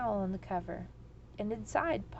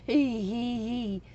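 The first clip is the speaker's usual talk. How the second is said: louder hee hee near sobbing; wailing laughter; 6 dB louder, slower than usual. A narrator's reading. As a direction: laugh till it nearly turns to sobs. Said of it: "wailed the dragon, almost sobbing".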